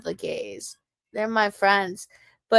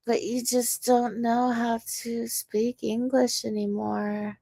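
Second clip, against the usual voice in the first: usual speaking voice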